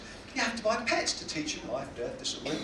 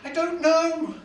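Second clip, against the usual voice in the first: high, loud acted onlooker; comic bystander reporting to the crowd; baffled bystander; 6 semitones higher, 6 dB louder, much slower than usual. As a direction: answer in a pitched-up crowd voice, stretching the end. Acts another bystander answering.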